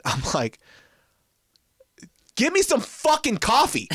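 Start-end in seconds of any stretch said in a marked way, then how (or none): 2.4-3.9 high-pitched exasperated outburst, half laughing; comic re-enacted anger; retold mad demand. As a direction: act out being fed up, voice shooting up. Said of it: "And so I got so mad".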